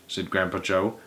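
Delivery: ordinary delivery, nothing marked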